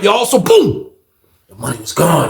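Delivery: ordinary talk, nothing marked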